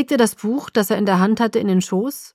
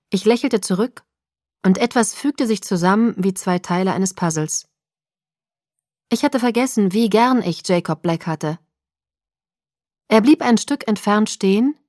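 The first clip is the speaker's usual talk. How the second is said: ordinary delivery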